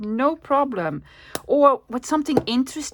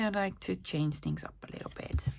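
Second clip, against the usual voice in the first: softer whispered confession to the viewers; whispered aside; 11 dB quieter than usual. Real, not acted. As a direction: drop to a playful whisper.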